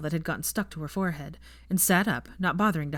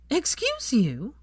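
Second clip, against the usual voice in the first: slow, high, mock-offended question; outraged but playful; drawn-out indignant excuse you; 9 semitones higher, much slower than usual. A narrator's reading. He slapped her.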